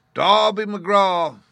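long eerie distant wail; ghostly wail. A narrator's reading. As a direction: wail the name like a lost spirit. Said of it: "it wailed".

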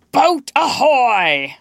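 loud, high, long-drawn shout; Hook's hail. A narrator's reading. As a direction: holler across the lagoon, stretching it out. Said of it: "rang over the lagoon in Hook's voice".

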